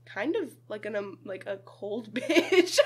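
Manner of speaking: drawn out slowly, searching for the words; slow, laughing hesitation; mock careful slow accusation; slow hesitant verdict. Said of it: "You'll be like".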